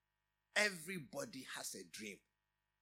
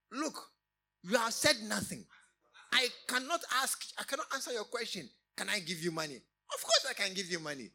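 firm loud answer; 5 semitones higher, 8 dB louder than usual. Retold or imitated. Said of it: "I said".

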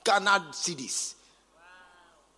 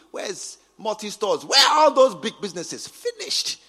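normal talking voice

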